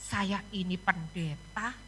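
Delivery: low, soft, slowing self-talk; dejected private thought. Retold or imitated. Pastor thinking to God that cancelling is impossible, dejected. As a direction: think aloud quietly, weighed down.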